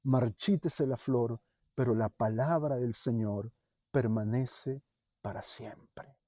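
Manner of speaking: hushed scripture recitation; scripture reading. Acted. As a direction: read the verse reverently, letting it die away. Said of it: "que dice".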